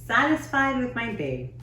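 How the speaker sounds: ordinary delivery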